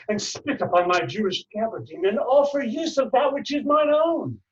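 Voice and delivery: theatrical outrage, pitched far up at the end; indignant outburst. Acted. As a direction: climb in pitch through the accusation. Monologue builds to an outburst.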